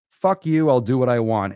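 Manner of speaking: loud, high defiant yell; defiant shout. Shown as a demonstration. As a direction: shout it right back at the critics. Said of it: "defiant shouted repeat".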